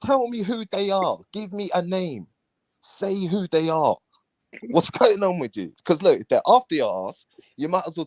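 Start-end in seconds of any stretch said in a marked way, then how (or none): 0.0-5.7 challenging demand. Pretend. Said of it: "Anyone could come online and say".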